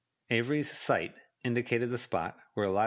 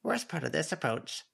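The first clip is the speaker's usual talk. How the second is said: high voice, grumbled under the breath; grumbling Snowdrop; 8 semitones higher than usual. A narrator's reading. Snowdrop complaining to Avery, muttered. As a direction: grouse like the little Snowdrop. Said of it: "Snowdrop muttered".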